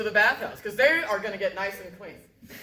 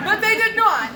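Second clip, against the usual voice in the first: emphatic; 9 semitones higher, 8 dB louder than usual. Acted. Loud punchline.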